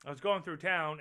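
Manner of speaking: ordinary talk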